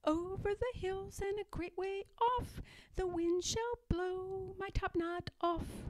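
high, soft, muffled little voice; sing-song from behind a cupboard door; little smothered voice. Acted. Pigwig sings from inside the locked cupboard, high and muffled. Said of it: "a little smothered voice chimed in".

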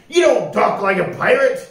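loud pirate order; bellowing pirate. A narrator's reading. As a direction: bellow the verdict, slowing on the sentence. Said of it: "cried Pete".

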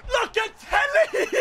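high laughing yell of the name, drawn out; delighted high-pitched cry; excited shout. Real, not acted.

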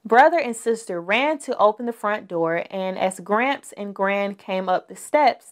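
plain reading voice

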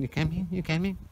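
mock impatient son; imitating his son. Dad imitates son hurrying him. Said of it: "You can hear him in the background".

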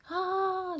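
high yelped aah on a shaky bridge; a sudden fright cry; short scream. A narrator's reading. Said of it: "закричав Расмус".

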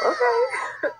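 sudden high squeal; excited yelp. Real, not acted. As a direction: squeal the word out. Excited at the mascara.

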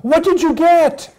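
high, excited puppy question; eager puppy. Acted. Puppy greets returning mother, eager little puppy voice.

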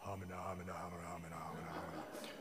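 unvoiced huddled muttering; whispered murmur. A narrator's reading. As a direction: whisper it like conspiring advisors.

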